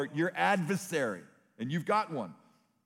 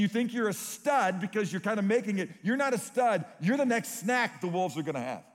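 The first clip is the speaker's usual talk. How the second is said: preaching at full force; 5 semitones higher than usual. Retold or imitated. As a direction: drive it home to the congregation. Retold exhortation to leaders.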